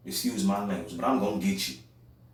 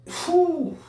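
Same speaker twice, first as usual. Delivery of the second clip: sharp high whew. Real, not acted. Exhaling after a heated rant.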